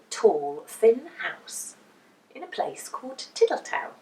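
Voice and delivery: plain reading voice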